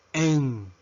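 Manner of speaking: letter M pronounced "em"; English letter M. Shown as a demonstration. Teacher modelling the English alphabet, M as "em". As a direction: say M as one-syllable "em". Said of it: "La letra M".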